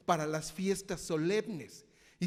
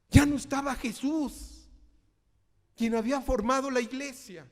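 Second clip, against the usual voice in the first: much higher and louder, preaching at full force; fervent preaching; 7 semitones higher, 7 dB louder than usual. Real, not acted. Preacher booming the climax of his point to the congregation. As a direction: ring it out to the whole church.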